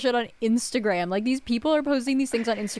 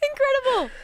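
delighted. Real, not acted. Amused reaction to joke.

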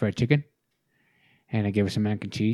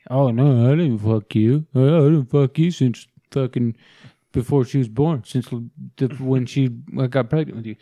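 louder, sneering denial; mocking the ex; 3 semitones higher, 8 dB louder than usual. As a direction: parody her protest, hammed-up and loud. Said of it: "she won't admit".

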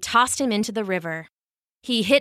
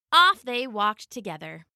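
usual speaking voice

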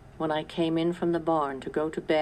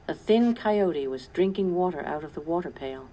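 ordinary delivery, nothing marked

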